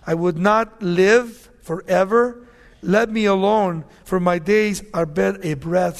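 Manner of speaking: loud, slow, heavy preaching read; scripture reading. Acted. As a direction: lay down Job's despair slowly. Reads Job wishing to die.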